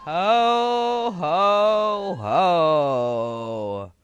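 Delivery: a light Santa laugh, drawn out. Acted. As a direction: give your best Santa ho ho ho. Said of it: "do your best version of".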